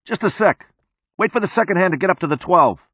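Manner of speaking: high, quick call to hold on. A narrator's reading. Cop calls out to the timers.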